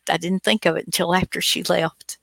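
usual speaking voice

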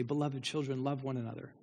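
put-on soft, frail old apostle voice; old John's refrain. Aged John blessing the believers, softly. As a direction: speak it gently like a dying old man. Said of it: "and he'd say".

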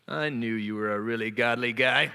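mock inner pride voice. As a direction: flatter yourself smugly. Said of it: "pride voice in my heart".

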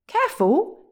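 high, loud cross shout. A narrator's reading. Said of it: "shouted William crossly".

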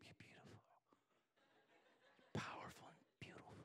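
soft breathy whisper in her ear. Shown as a demonstration. Husband whispering to his sleeping wife, tender whisper. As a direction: whisper it close as she falls asleep. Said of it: "whispering her in her ear".

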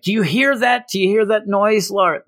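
high, anxious questioning; lightly voiced panicked heroine; mock frantic Psylocke. Psylocke asking Laura about the noise, high and anxious.

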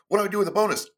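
delighted at too many choices; excited dilemma. Retold or imitated. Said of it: "It's like".

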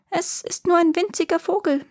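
tiny high-pitched peep; squeaking. A narrator's reading. Boy posing as a bird to fool the giant, squeaking. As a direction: pipe it up high like a little bird. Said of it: "piepste der Junge".

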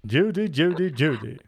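lightly voiced mock movie-star impression; a hint of an old film star's voice; Cary Grant. Host quoting the film star to co-hosts, lightly impersonated. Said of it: "Cary Grant, I believe".

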